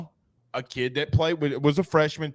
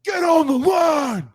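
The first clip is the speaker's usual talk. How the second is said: high, drawn-out coach scream; full-voiced retold yell, pitched way up; screamed command; 13 semitones higher, 5 dB louder than usual. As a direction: imitate a coach screaming an order, stretched out. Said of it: "He screams at us".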